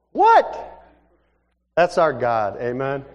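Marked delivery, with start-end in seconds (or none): 0.1-0.8 very high, loud "What?"; astonished outburst. Acted. Preacher reacts to the answered prayer, high astonished cry. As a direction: shoot up in loud mock disbelief. Amazed reaction to story's punchline.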